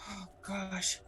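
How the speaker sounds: breathy whisper of sorrow; whispered shock at bad news; whispered dismay. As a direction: hush it under your breath. Hearing of Nicky's death.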